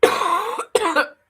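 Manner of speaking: coughing and choking on milk; sputtering after inhaling milk; choking on a drink. Real, not acted. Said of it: "I'm choking".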